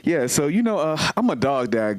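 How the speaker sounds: ordinary delivery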